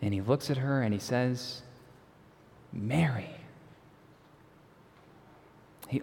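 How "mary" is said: soft, gentle utterance of her name; tender calling of a name. Acted. Jesus revealing himself to weeping Mary by name, tenderly. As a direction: say the name softly, letting it land.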